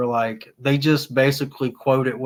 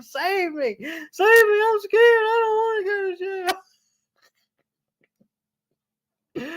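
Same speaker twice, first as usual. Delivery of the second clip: mock scared pleading. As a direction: beg like a terrified man mimicked for laughs. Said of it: "He's like".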